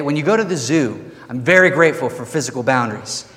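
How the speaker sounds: normal talking voice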